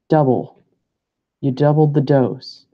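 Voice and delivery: normal talking voice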